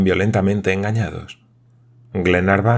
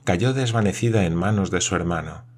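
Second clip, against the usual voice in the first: usual speaking voice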